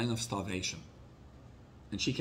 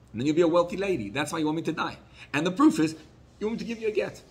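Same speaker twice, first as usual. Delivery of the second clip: high, louder accusing voice rising to a peak; husband's rising suspicious accusation; bitter accusation; 6 semitones higher, 4 dB louder, faster than usual. Retold or imitated. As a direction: throw the charge at her. Husband accusing his wife.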